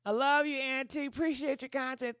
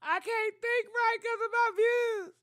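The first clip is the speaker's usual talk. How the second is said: high, louder, through laughter; laughing lament; 10 semitones higher, 4 dB louder than usual. Real, not acted. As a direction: laugh at her own worry. Laughing lament about views.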